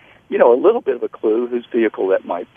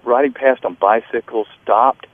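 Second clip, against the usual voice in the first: usual speaking voice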